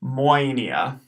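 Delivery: moinia with OE as oi; diphthong demo. Shown as a demonstration. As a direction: model moinia clearly. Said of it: "pronounced moinia".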